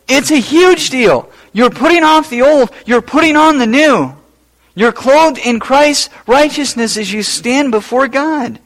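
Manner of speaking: high, loud preacher's proclamation; fervent preaching. Acted. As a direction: proclaim it to the congregation with fire. Preacher hammering the point.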